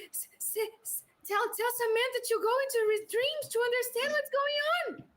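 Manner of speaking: panicked urging. Acted. Panicked request mid-scene.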